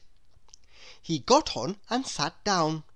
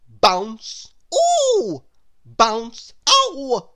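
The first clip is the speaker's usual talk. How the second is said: loud, alternating bounce and pain cries; bounces and yelps; 8 semitones higher, 10 dB louder, much slower than usual. Acted. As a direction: get smacked each time. Hit back and forth by rackets.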